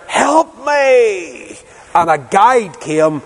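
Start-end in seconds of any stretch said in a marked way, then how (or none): 0.1-1.6 high, far-carrying cry for help; retold shout. Harris stuck in the maze, shouting high for help. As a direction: call out over the hedge for rescue.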